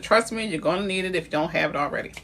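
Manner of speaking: ordinary delivery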